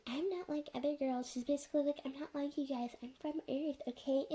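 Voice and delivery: high-pitched mock complaint; mocking imitation. Mocking a character's self-pitying claim.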